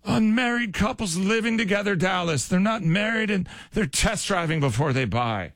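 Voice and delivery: high-pitched mock outrage at the youngsters; mock scandalized. Host airing the elderly's complaints to Dallas, mock scandalized.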